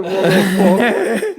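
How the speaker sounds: slow, drawn-out what the fuck through laughter; laughing disbelief. Real, not acted. Friend hears his trip story, slow laughing disbelief. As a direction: let the laugh stretch the words out.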